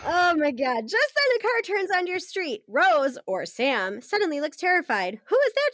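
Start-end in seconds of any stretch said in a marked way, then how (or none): 5.3-5.7 high, scared question; frightened cry. Acted. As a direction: cry out in sudden fear. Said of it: "she cries".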